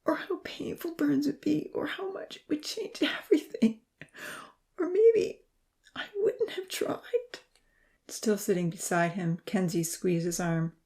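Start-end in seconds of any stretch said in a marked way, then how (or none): 0.0-7.4 very high, breaking voice; high, strained and slowing at the end. A narrator's reading. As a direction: break into anguish as you confess. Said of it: "His voice broke".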